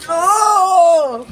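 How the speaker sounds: loud high yell; shouting yes. Real, not acted.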